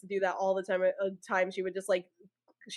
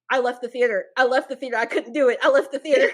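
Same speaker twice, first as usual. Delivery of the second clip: louder, higher, insistent repetition; mom's voice; 4 semitones higher, 9 dB louder than usual. Retold or imitated. Mom's warning replaying in her head, loud and emphatic. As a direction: imitate mom exclaiming over and over. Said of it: "had my mom's voice".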